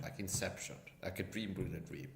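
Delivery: usual speaking voice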